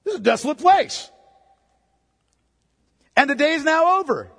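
mock the disciples stating the obvious to Jesus; mock informing. Preacher mocks disciples telling Jesus the obvious, know-it-all. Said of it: "Like they were giving Jesus information he didn't know".

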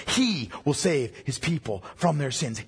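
"he" pressed firmly at the start; stress on HE. Shown as a demonstration. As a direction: lean on the word "he", the rest even. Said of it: "placing emphasis in three different places".

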